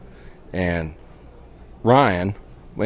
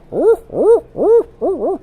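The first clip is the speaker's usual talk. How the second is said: a much higher, convincing owl call; good owl hoot; 7 dB louder, slower than usual. Shown as a demonstration. Showing his buddy how a proper owl hoot goes, high owl call. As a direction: hoot it like a real owl in the woods. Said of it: "that sounds like an owl".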